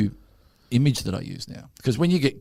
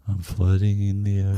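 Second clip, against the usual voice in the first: calm mantra; 4 semitones lower than usual. Retold or imitated. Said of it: "I just went".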